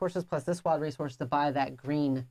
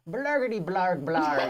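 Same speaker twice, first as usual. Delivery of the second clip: slow, laughing monster gibberish; chaos beast noise; slower than usual. Retold or imitated. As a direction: growl out nonsense like a beast. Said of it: "Astrid used to ... and go".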